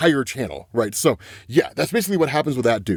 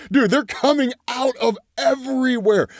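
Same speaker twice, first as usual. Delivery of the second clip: high, excited rush of words; really excited, the pitch jumps up; 8 semitones higher than usual. Real, not acted. Recapping a comic to viewers, bursting with high excitement. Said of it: "I'm sorry I'm so excited".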